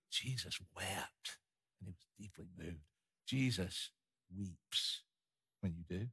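very soft and low, with long pauses; hushed solemnity. Real, not acted. Preacher telling the congregation Jesus weeps with them, hushed. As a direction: drop almost to a murmur, phrase by phrase.

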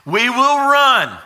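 high, emphatic refusal; mock-panicked soldier's protest. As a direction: cry out like a balking soldier, pitched well up. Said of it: "he looks up and he's like".

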